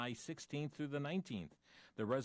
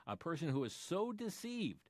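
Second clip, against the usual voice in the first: ordinary talk, nothing marked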